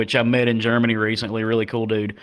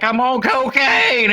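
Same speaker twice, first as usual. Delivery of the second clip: high, slow mock voice of a high clubgoer; lightly cartoonish high drugged guy; imitating the screaming guy; 13 semitones higher, 4 dB louder, slower than usual. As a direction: ham up a wasted stranger's confession. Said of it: "And he's like".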